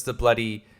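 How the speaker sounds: ordinary talk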